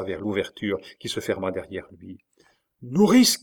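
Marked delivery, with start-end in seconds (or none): 2.8-3.4 high, loud rising cry; louder call. A narrator's reading. Dying king calls his nurse, louder cry. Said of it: "cria le roi d'une voix plus forte".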